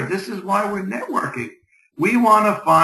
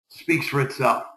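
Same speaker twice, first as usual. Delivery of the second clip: ordinary talk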